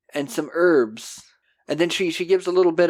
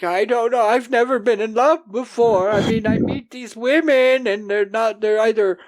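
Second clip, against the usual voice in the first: high, whiny mock weeping; mock crying; 7 semitones higher than usual. Wayne overwhelmed after love making, mock tearful. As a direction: imitate an overwhelmed man crying, pitched high. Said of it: "Wayne is crying and crying".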